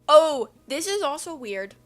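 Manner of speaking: loud, high exclamation; surprised discovery. Real, not acted. Reader finding another weird passage, surprised exclamation. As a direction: exclaim it on spotting another oddity. Exclaims, then repeats more calmly.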